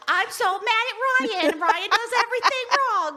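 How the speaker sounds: high, slow mock-angry whine; loud, squeaky hammed-up complaining; mocking wife impression. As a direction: mimic a ranting wife for the dogs. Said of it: "He'll be like".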